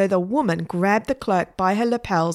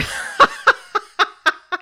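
high-pitched giggling fit; loud squealing laugh; high helpless giggle; 6 dB louder than usual. Acted. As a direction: giggle uncontrollably, much higher. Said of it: "couldn't stop laughing".

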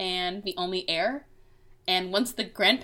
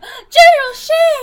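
high, loud squeal of delight; excited kids shouting; 12 dB louder, slower than usual. Retold or imitated. Kids fangirling over the general, squealing loud and high. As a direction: shriek it like an excited child. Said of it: "all the kids are like".

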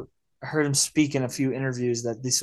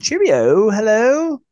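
higher, louder, hammed-up posh English accent; 8 semitones higher, 7 dB louder, much slower than usual. Imitating the dad's English phone voice, hammed-up English accent. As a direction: chirp it like a mock English gent. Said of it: "dives into that, like, English accent".

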